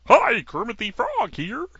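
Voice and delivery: high, slow frog puppet voice; a frog puppet greeting, voice pushed up high; Kermit the Frog impression. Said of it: "I can't do Kermit very well".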